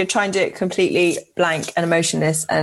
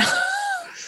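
high, soft burst of laughter; 6 dB quieter than usual. Real, not acted. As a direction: laugh at your own cheeky joke. Laughs at own joke.

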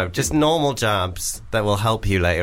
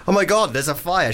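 higher-pitched pretend panic; mock alarm; 5 semitones higher than usual. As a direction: fake discovering a fire, pitch up. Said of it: "pretend to wake up and be like".

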